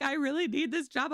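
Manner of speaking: high, imitated plea; pleading employee. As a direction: play an upset worker begging. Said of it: "she was like".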